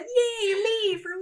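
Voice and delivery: very high, squeaky little yay; self-mocking cheer. Real, not acted. Speaker celebrating learning something on air, high self-mocking cheer. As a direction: cheer yourself on, half mocking. Cheers herself after being corrected.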